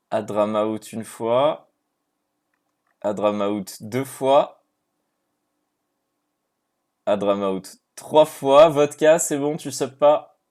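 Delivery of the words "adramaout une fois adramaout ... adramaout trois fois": mock auctioneer count. Auction-style countdown before confirming pick.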